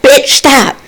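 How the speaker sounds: high-pitched yell down the steps; retold yell. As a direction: act out hollering at a noise below. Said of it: "I had to yell down the steps like".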